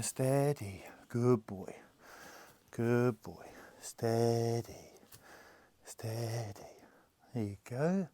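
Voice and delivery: hushed, near-whispered reassurance; soothing the horse. Real, not acted. Trainer calming a horse that kicks, hushed reassurance. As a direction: settle him down softly.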